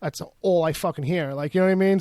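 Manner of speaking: ordinary delivery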